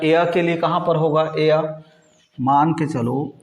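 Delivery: ordinary talk, nothing marked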